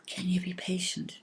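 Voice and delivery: soft hushed question; the cloud softly asking the fairy; whisper. A narrator's reading. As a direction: whisper it kindly.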